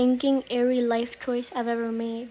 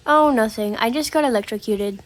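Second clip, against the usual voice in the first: casual. Retold or imitated. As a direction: toss it off like small talk about the weather. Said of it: "he said it so casually".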